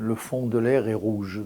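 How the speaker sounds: quoted saying, spoken in native French; French phrase said with a French accent. Retold or imitated. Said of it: "we said".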